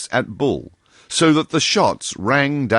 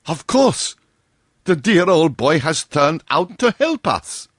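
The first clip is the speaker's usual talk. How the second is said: relieved colonel; 8 semitones higher than usual. A narrator's reading. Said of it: "exclaimed the colonel".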